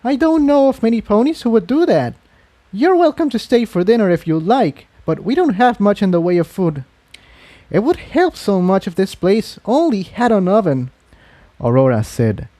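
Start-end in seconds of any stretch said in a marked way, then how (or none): 0.0-6.8 put-on higher, friendly young mare offering dinner; Aurora's voice. A narrator's reading. 7.7-10.9 put-on higher young mare, pouting complaint. A narrator's reading.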